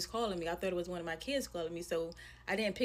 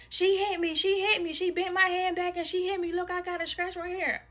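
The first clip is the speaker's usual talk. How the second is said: higher, louder mock little-girl complaint; imitated tattling child; 8 semitones higher, 5 dB louder than usual. Little girl telling her mom who hurt her, loud mock tattling. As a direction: complain like a kid telling on someone.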